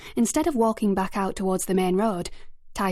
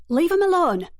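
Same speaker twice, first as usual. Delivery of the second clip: much higher, loud forceful shout; bark-like shout; 10 semitones higher, 6 dB louder than usual. A narrator's reading. Narrator shouting at the boys to leave Titus alone. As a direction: bark it out to stop them. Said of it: "in a bark-like shout".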